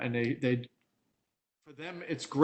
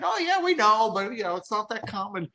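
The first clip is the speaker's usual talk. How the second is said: much higher, put-on casual customer voice; mock customer reply; 8 semitones higher, 3 dB louder than usual. Customers brushing off the product, imitated in a high mocking voice. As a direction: lightly mimic an offhand buyer saying they knew.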